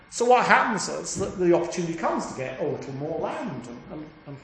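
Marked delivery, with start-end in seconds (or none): none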